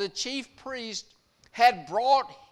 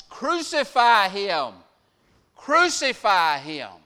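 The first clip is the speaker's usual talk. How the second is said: loud chant of the demand, the first one higher; crowd shouting; 3 semitones higher, 10 dB louder than usual. Acted. Mob outside the headquarters demanding Jesus die, loud shout. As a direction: roar it twice like an angry mob. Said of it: "the crowd shouts".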